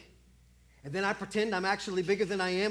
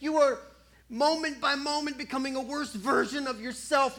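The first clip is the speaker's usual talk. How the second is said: loud, higher, insistent scolding; exasperated parent scolding; 7 semitones higher, 6 dB louder than usual. Acted. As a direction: lecture the kid who won't go to bed.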